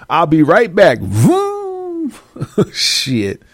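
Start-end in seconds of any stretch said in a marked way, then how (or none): 1.0-2.1 high, squealing engine noise with a laugh; imitating a car tearing off; car vroom. Making a getaway, a high laughing engine vroom. Mimics speeding away.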